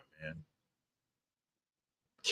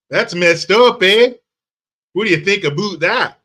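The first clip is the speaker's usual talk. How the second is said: higher-pitched caricature Canadian; mock Canadian accent; 5 semitones higher, 3 dB louder than usual. Streamer taunts a Canadian viewer, mock Canadian accent. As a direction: put on a hammy Canadian "eh" and "aboot".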